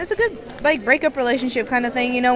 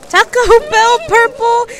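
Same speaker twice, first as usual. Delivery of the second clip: amused; 11 semitones higher, 4 dB louder, slower than usual. Real, not acted. Echoing a friend's answer.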